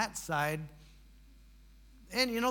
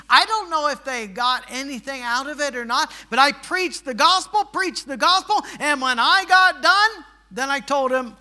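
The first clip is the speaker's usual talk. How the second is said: loud, raised-pitch pulpit delivery; full-force preaching; 7 semitones higher, 13 dB louder than usual. Real, not acted. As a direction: drive it home to the congregation with force.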